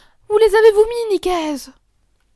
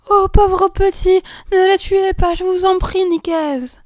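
put-on high, slow begging girl; pleading; 9 semitones higher, 8 dB louder, slower than usual. Girl begging the keeper to spare the babies, pleading. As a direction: beg him tearfully to spare them.

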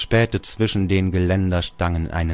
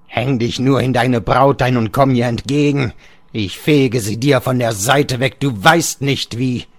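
higher, louder sneering taunt, slowing on the last words; mocking father; 6 semitones higher, 6 dB louder than usual. Father sneers at Georg about his bride. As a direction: jeer at him as the scornful father.

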